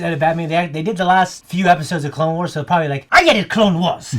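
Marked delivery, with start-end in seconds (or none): 3.1-4.1 loud, high mock Spanish accent; hammy Spanish accent, pitched up. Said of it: "I was trying to do some Spanish accent".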